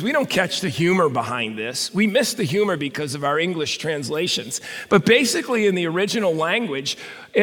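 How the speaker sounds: usual speaking voice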